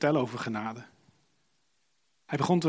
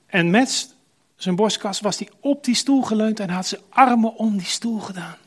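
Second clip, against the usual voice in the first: intense climax; 6 semitones higher, 7 dB louder than usual. A narrator's reading. Climax of the story.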